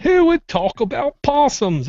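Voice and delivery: high, slow disbelief; who on earth would, stretched out high; incredulous. Real, not acted. Host puzzled by a chat topic, high and slow. Reads odd topic suggestion aloud.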